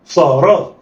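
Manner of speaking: "صا را" joined in one quick go; snatch "صا را" with no pause; snatched together. Shown as a demonstration. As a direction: blend "صا را" like one word. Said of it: "يلا، اخطف".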